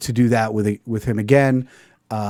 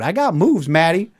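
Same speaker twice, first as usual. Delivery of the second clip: ordinary delivery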